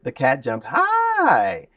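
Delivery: "high" drawn out long and lifted high; word painting on high. Shown as a demonstration. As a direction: stretch "high" into a soaring arc. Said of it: "I did something with the word high".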